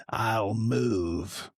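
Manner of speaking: lightly put-on low, drawn-out quote; mock a terse old wrestler's answer; old wrestler's punchline. Quoting an old wrestler story's punchline.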